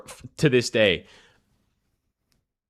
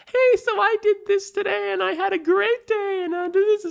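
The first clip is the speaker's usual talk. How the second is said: put-on much higher chirpy girl voice; girlfriend voice. Mocking how his girlfriend texted him, a high bubbly voice. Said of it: "and she's like".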